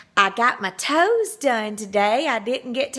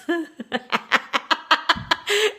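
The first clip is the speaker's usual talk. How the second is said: high, breathy burst of laughter; just crack up; real laugh; 7 semitones higher than usual. Real, not acted. Woman cracking up at the stranger's compliment, laughing hard.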